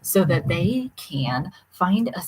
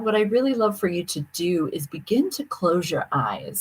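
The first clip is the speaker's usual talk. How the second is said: ordinary talk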